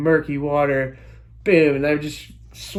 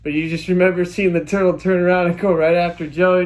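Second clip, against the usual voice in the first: usual speaking voice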